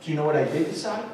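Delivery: ordinary talk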